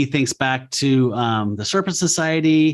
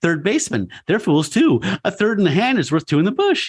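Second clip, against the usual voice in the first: ranting like the Joker; 6 semitones higher than usual. Retold or imitated. Patient raving in his padded room, manic and high. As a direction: rave in jumbled leaps like a lunatic. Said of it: "he just starts ranting like the Joker".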